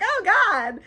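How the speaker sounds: a half-laughing cry of dismay; dismayed exclamation. Retold or imitated. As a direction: groan it out in disbelief. Viv dismayed, left alone.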